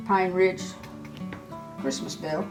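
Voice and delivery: ordinary talk, nothing marked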